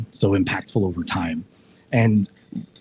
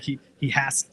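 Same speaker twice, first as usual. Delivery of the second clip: usual speaking voice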